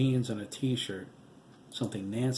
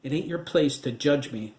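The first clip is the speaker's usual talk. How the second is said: louder, angry growl; 4 semitones higher, 8 dB louder than usual. A narrator's reading. Widow growling back at her ghost husband, louder. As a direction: bite the words out hard and loud. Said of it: "Nancy growled".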